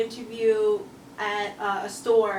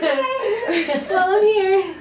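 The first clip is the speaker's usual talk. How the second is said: laughing retort; 9 semitones higher, 8 dB louder, much slower than usual. Retold or imitated. Her awkward reply to interviewer, retold.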